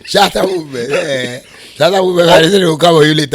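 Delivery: loud, high teasing shout; playful shout. Real, not acted. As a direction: yell it back in mock protest. Teasing banter, laughing.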